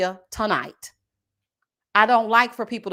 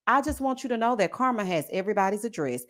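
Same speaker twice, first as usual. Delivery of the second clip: ordinary talk, nothing marked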